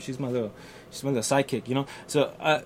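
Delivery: normal talking voice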